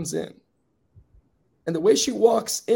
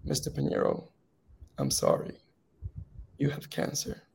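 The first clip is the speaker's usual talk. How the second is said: doctor's news, voiceless and much softer; doctor softly delivering a cancer diagnosis; hushed; 4 semitones lower, 10 dB quieter than usual. Retold or imitated. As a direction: break it gently in a near-whisper. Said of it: "and saying".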